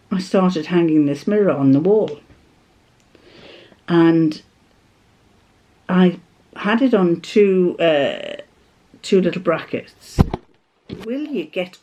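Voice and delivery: ordinary talk, nothing marked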